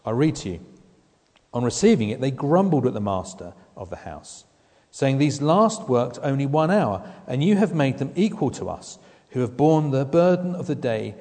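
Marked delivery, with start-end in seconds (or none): 1.5-11.2 a preacher reading the complaint aloud; scripture reading. Acted. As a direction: read the grumbling out with weight.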